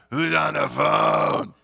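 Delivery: loud, slow call from across the room; loud distant call; called from the background. Retold or imitated. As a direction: shout it from a hospital bed nearby. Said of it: "I hear in the background".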